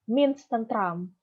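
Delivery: ordinary delivery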